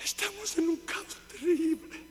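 high, soft, pained exclamation; hushed lament. Real, not acted. Preacher grieving over the church's chaos, hushed lament.

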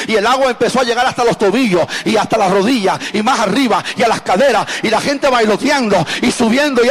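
loud, driving Pentecostal preaching; rising sermon fervor, near shouting; full-force preaching. Acted. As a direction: preach it to the rafters, building the crowd up.

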